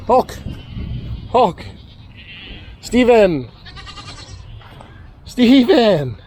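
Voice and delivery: high calling voice across the yard. Real, not acted. Farmer calling his animals from afar. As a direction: call the animals from a distance.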